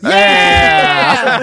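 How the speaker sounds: big excited yell; loud cheer. Real, not acted. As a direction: shout it out. Shouting excitedly.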